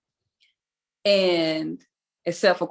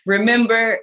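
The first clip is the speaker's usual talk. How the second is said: loud catchphrase; 4 semitones higher, 6 dB louder, slower than usual. Shown as a demonstration. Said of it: "what you say, Isis?".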